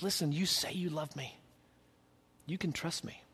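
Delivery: soft, calm voice of God; gentle reassurance. Acted. God calmly reassuring a frightened believer, softly. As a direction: reassure quietly, like a patient father. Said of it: "and he says".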